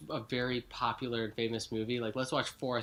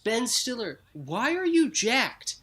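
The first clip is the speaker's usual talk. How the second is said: mock outraged rant; 8 semitones higher, 7 dB louder than usual. Ranting at Ben Stiller.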